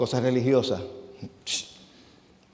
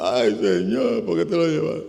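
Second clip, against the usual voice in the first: hammed-up crying to the Lord; a touch of a mourner's wail, slowed; mock wailing; 3 semitones higher than usual.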